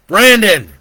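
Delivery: loud high call across a room; shout. A narrator's reading. Joe shouts across the room to Brandon, loud. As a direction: bellow his name to wake him. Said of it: "he shouted across the room".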